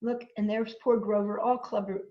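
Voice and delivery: usual speaking voice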